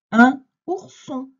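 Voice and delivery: loud, high, slow word presentation; emphatic dictation. Shown as a demonstration. Teacher announcing a word to a pupil, loud emphatic dictation. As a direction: call the new word out slowly and strongly.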